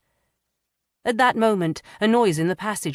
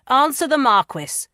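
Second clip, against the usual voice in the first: high, loud command; loud, much higher shouted command; 9 semitones higher, 7 dB louder than usual. Acted. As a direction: shout the order down at him. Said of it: "Pomfroy shouted".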